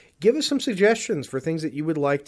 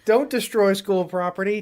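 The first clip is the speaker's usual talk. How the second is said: higher, pushed-out quote; raised quoting voice; 5 semitones higher, 3 dB louder than usual. Acted. Co-host quoting a show for his partner, raised scolding voice. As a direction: call it out like a scolding.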